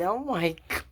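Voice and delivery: ordinary talk, nothing marked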